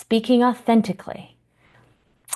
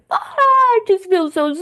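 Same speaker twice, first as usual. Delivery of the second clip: high, loud, hammed-up wail of sadness; theatrical mock lament, much higher and louder; exaggerated sadness; 13 semitones higher, 5 dB louder than usual. Shown as a demonstration. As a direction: overplay the sadness on purpose, playfully. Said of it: "you can exaggerate it and make it fun and playful".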